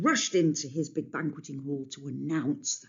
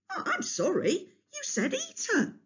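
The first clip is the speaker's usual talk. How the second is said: feigned innocence. A narrator's reading. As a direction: play dumb sweetly.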